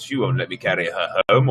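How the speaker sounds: ordinary delivery, nothing marked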